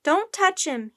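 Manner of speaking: high, loud warning; shouting. A narrator's reading. Jack defends the octopus from sailors, shouting. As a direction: shout to protect someone. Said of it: "he shouted".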